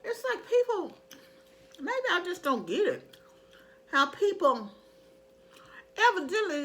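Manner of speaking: high, puzzled disbelief; can't believe people do this; baffled. Real, not acted. Baffled complaint about shoppers.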